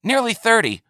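high, hopeful answer; eager buyer. A narrator's reading. Buyer answering the trader's question, high and eager. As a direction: jump in high with the number.

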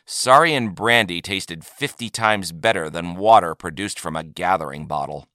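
ordinary delivery, nothing marked

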